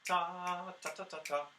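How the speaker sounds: long held ta, then a quick run of short tas; triplet rhythm. Shown as a demonstration. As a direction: sound out the rhythm in crisp tonguing syllables. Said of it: "And the triplets are".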